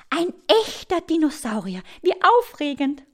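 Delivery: put-on high, excited girl's voice; delighted. A narrator's reading. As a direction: marvel with girlish delight. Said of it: "freut sie sich".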